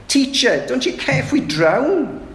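high, frantic plea of frightened disciples; panicked cry. Acted. Terrified disciples wake Jesus in the storm, frantic high cry.

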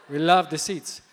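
loud, put-on African accent; delighted chief in a rough African accent. Chief enthusing to pastor about the seats, African accent. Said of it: "That's my African accent".

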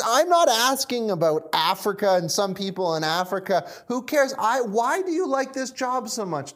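high, loud, exasperated protest; frustrated and confused. Acted. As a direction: throw it back at him, baffled and fed up. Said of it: "he's not only frustrated at this guy".

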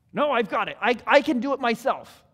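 loud, insistent I-can-do-it refusal; stubborn kid. Retold or imitated. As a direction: insist on doing it yourself.

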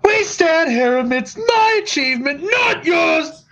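high shouted villain impression; Director Krennic. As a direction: bellow it like a furious Imperial. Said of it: "[name], give it".

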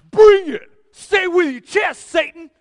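high, full-voiced mock battle cry; defiant shout. Peter daring Satan to sift him, defiant shout. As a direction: bellow it as a cocky challenge.

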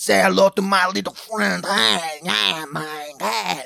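loud hammed-up Cuban movie accent; Scarface Cuban accent. The scam caller quoting Scarface, in a loud Cuban accent. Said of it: "crazy weird accent".

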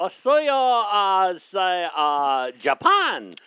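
loud, badly faked Japanese accent. Player in an accent fight, terrible Japanese accent. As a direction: blurt out a crude mock Japanese accent.

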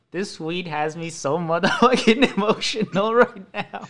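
slow, giggly retelling; laughing. Retold or imitated. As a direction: laugh through the confession. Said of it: "I was like, to Lizette".

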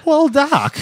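high put-on voice for the Doc line; quoted old scientist. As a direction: squeak it out like a caricatured old professor.

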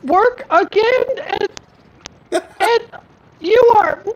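very high, choppy mom voice; loud fragments through a failing connection; breaking-up phone call. Acted. Mom scolds over bad line, high voice cutting out. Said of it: "the call drops".

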